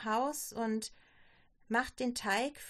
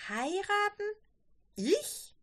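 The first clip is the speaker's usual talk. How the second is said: higher, startled questions; taken aback; 9 semitones higher, slower than usual. A narrator's reading. Daughter hears of a possible marriage, taken aback. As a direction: question it in disbelief, pitched up. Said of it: "stotterte Barbara".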